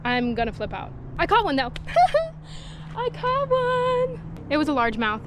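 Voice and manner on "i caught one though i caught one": very high laughing squeal; high giggly joy; laughing delight. Real, not acted. Happy about the catch.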